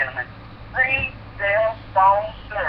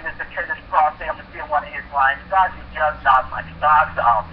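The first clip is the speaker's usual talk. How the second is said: ordinary talk, nothing marked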